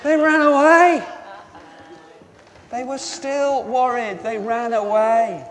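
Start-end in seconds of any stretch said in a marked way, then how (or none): 0.0-1.0 loud, high exclamation; calling out. Acted. Preacher telling children what the women did, in a loud cry. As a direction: blurt it out at full voice.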